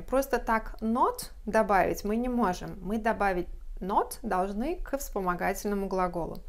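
ordinary talk, nothing marked

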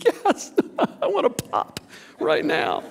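high, laughing, eager churchgoer voice; mock eager listener. Preacher imitates congregation members eager to go to heaven, high and laughing. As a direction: play the overexcited listener, laughing. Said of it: "some of you are thinking".

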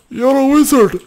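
very high, loud cry of amazement; exclaimed film quote. Acted. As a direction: belt out the wizard line, way up high. Louder repeat of film line.

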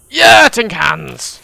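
drawn-out loud joyful yell; excited cheer. Real, not acted. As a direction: cheer at finding food. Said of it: "Never thought I'd be that excited".

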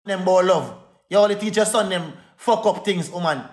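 ordinary talk

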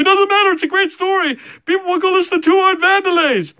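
high, loud mock shout; excited overriding retort. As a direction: override the objection in a strained high voice. Acted retort in imagined quarrel.

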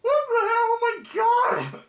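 high shrieking disbelief; shocked yell. Real, not acted. Seeing his younger self on screen, shrieks in shock. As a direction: yelp in horror at what you see.

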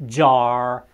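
jar with no R and a long vowel; British jar. Shown as a demonstration. Teacher showing British jar, R dropped. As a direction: drop the R in jar. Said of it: "vom ști să pronunțăm".

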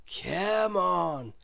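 drawn-out beckoning call; a hint of dreamy voice; lightly voiced Mister Daydream. A narrator's reading. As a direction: beckon him along. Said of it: "said Mister Daydream to Jack".

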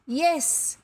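high, ringing yes; ringing answer. A narrator's reading. Boy boasts in guessing game, ringing out. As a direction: let it ring out proudly. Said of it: "the answer that rang out".

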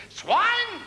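high shrieked angry German voice; shouted German. Acted. German on the beach yelling at the pig, high mock shriek. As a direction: screech it at the pig like a furious German.

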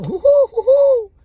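very high, loud hooting cry; hunter's call. A narrator's reading. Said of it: "escucha un grito". (grito agudo del cazador a lo lejos)

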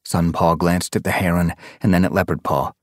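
usual speaking voice

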